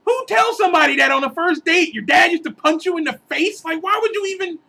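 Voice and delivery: high-pitched, outraged disbelief; incredulous outburst. Acted. Comic griping about a first-date confession, incredulous. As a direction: rant in disbelief, voice jumping way up.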